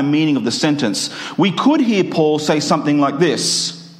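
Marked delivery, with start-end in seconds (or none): none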